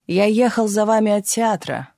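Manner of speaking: urgent but contained shout; calling out after her. A narrator's reading. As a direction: call after someone walking away. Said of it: "выкрикнул ей вслед испанец".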